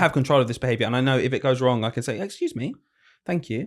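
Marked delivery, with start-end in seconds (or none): 2.2-3.7 soft, high-pitched polite teacher prompt; usual classroom reminder. Retold or imitated. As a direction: gently call pupils to order, lightly and high. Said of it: "my usual".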